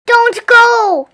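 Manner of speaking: loud, high desperate wail; anguished loud plea. A narrator's reading. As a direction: beg him not to leave. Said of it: "wailed Bear".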